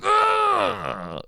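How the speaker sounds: long, high scream of pain; demonstrated agonised yell; full-out scream. Retold or imitated. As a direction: let the pain out properly in a scream. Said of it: "letting it out properly".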